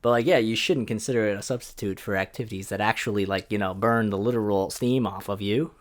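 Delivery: ordinary talk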